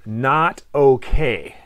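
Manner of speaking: NOT OKAY drawn out slowly, each word weighted; emphatic refusal. Shown as a demonstration. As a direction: stretch out "Not okay" as a firm no. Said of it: "it's a very emphatic".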